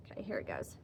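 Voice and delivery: hushed whisper. Real, not acted. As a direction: breathe it as a secret. Whispered aside before moving on.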